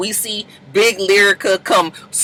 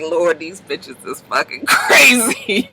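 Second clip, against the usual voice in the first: weary disbelief; 5 semitones lower, slower than usual. Retold or imitated. Ray J exasperated.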